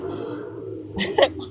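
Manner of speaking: soft airless retold giggle; breathy giggle. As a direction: giggle under your breath like a smitten girl. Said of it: "So I'm laughing, tee-heeing".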